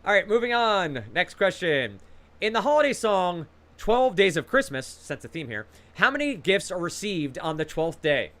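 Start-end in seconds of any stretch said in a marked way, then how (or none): none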